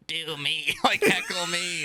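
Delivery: flat robotic speech voice with laughing. Retold or imitated. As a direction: imitate a robotic speech device. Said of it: "he's got the, like, robot voice".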